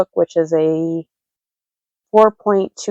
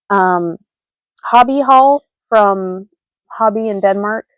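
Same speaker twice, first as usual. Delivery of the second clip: normal talking voice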